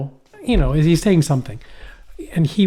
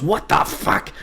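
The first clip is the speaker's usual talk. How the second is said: high, husky mock outcry; retold shocked outburst; 5 semitones higher, slower than usual. Dreamer reacting to the kid's words, high mock shock. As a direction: act out appalled disbelief. Acted horrified reply in dream.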